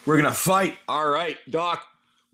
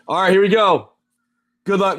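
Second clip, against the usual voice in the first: loud, pitched-up kickoff; excited; 5 semitones higher, 7 dB louder than usual. Real, not acted. Host kicking off the pack opening, excited and loud.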